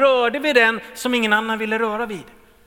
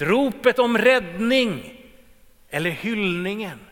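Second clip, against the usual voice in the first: ordinary talk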